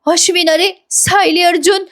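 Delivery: high, plaintive imitation of crying; mock tearful. Priya brings Ashwin bad news, mock tearful. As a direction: wail it lightly, as if breaking bad news. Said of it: "Priya रडत रडत म्हणते".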